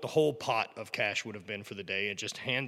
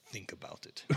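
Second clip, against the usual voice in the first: put-on whispered, softer Scottish accent; old Scot whispering a legend; 2 semitones lower, 12 dB quieter than usual. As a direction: lean in and whisper it in Scottish brogue. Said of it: "He's like".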